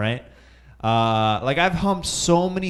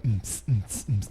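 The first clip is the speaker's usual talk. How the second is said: techno beatbox; 2 semitones lower, 5 dB quieter, faster than usual. Retold or imitated. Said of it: "techno music playing".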